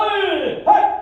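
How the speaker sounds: explosive high kiai; sharp, loud combat yell; martial arts shout. Real, not acted. Instructor demonstrating a kick to the class, shouting.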